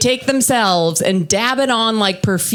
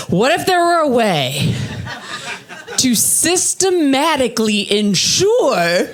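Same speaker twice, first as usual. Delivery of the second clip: higher, stretched-out grand proposal; mock scheming; 5 semitones higher, slower than usual. Comic pitching a scheme to boyfriend, mock visionary. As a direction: muse aloud as if hatching a sinister plan.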